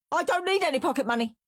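high, fast shriek. A narrator's reading. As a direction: blurt it out in a shriek. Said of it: "shrieked Henry".

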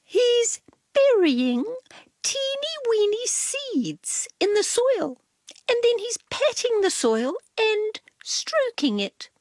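prissy cat explaining; Cat's voice. A narrator's reading. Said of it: "said Cat".

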